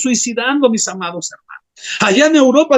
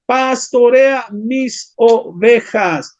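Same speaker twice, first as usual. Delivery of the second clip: "Pastorea mis ovejas" drawn out very slowly, each word weighed; slow solemn command; much slower than usual. Acted. Preacher giving Jesus' charge to Peter, very slow and solemn. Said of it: "Jesús le dijo, ¿qué?".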